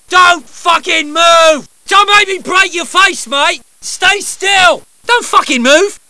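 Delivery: menacing yelled warnings; threatening shout. Acted. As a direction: threaten them at full voice. Threatening barks.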